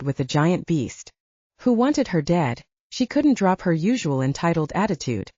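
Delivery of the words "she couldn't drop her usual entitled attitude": plain reading voice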